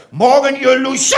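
loud, high parade-ground bellow; drill instructor shout. Acted. Instructor greets his recruits, drill shout. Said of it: "wie begrüßen Sie morgens Ihre Rekruten".

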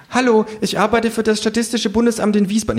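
high-pitched survey taker's greeting; high, put-on pollster voice; student pollster. A narrator's reading. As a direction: chirp out an official introduction in a high voice. Student pollster accosts the narrator.

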